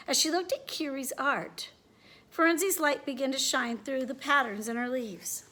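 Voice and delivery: ordinary delivery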